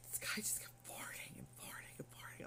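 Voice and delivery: hushed, repetitive griping; whispered complaint. Retold or imitated. Patient complains about farting neighbor, whispered complaint. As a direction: whisper it so the neighbor won't hear.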